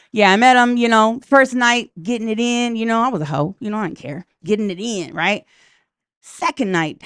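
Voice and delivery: usual speaking voice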